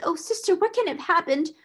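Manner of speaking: high-pitched, tearful wail; sniffling. A narrator's reading. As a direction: plead in a high, distressed voice. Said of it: "she sniffed".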